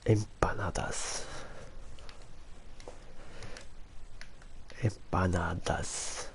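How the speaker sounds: soft Filipino accent impression. Shown as a demonstration. Asking the price of empanadas, mock Filipino accent. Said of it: "Here's my Filipino accent".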